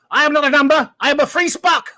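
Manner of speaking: loud, high theatrical cry; mock defiant yell. Host voices Cockney rebelling against the village, mock yell. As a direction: shout it out as a hammed-up declaration. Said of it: "And then Cockney yelled".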